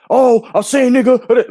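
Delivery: high, loud, slow mock of H Money. Mocking a rival streamer's loud taunt on the stream, slow and high. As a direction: ham up a cocky stream loudmouth.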